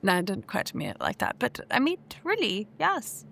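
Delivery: flustered. Real, not acted. Flustered flirting reply.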